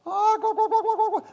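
high, squawking turkey imitation; turkey call. Guide calling the tom in, mock turkey squawks. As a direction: gobble it out like a hen turkey. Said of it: "kind of sound turkey makes".

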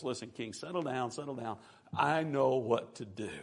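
low, hushed, settling someone down; softly spoken, steady reassurance; calming reassurance. Acted. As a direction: soothe them quietly, slowing on the last line. Elisha soothes the panicking king.

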